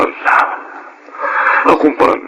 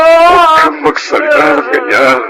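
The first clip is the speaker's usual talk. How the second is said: high tearful plea; crying plea; 13 semitones higher than usual. Acted. Husband begs grieving wife not to say that, crying. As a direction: beg her through tears.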